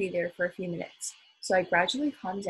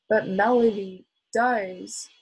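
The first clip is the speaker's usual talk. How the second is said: "does" drawn out and loud; slow, louder protest leaning hard on "does"; insistent stress; 6 dB louder, much slower than usual. A narrator's reading. Teacher arguing back at the mother, "does" heavily stressed.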